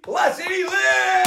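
high, slow showman yell of "he lives"; drawn-out hype shout. Real, not acted. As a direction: stretch it out like a ring announcer. Hyping the caller on air.

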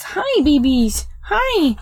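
high, slow cooing at the dogs. Real, not acted. Greeting her dogs climbing on the bed, high cooing baby talk. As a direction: coo up high to the puppies.

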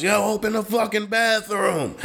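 high, shouted demand through laughter; retold shout from a drunk customer demanding the bathroom; mock drunk yell. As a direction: bark it like an angry drunk at a locked door. Drunk guy banging on door.

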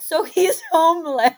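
loud laughing mock conclusion; laughing punchline. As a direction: blurt it out through a laugh. Mocking punchline about friend.

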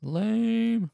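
high, held-out jeer; mocking heckle. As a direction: draw it out like a heckler in the crowd. Heckles friend's flopped story.

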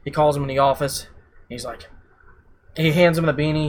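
normal talking voice

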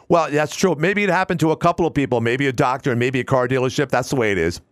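breezy talk-radio voice settling down at the end; Bill Pags impression. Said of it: "he kinda talks like this".

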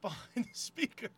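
normal talking voice

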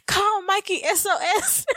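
high, giggly distress call; high mock-desperate cry for help; laughing mock SOS. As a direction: send a squealed plea through laughter. Cracking up retelling distress text.

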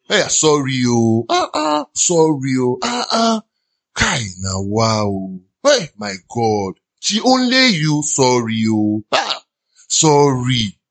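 low drawn-out mock pity, then squeaky ah ahs; mock sympathy. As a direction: overdo the sorrowful sorry. Mocking people saying sorry.

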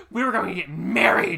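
loud, overwrought quote with a big punch on MARRIED; hammed-up movie character. Fan acting Rebecca Gayheart's scene for friend, hammed-up and loud. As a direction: blast it out melodramatically. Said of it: "she's like, she's like".

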